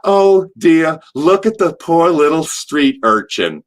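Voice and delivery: mock pity with a pinch of the cheek; lightly voiced fussing mother; Missus Hart. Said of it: "She pinched my cheek and she goes".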